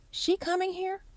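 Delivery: high, hushed disbelief; shocked. A narrator's reading. Darius hearing the wife is coming, stunned. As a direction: ask it in stunned alarm.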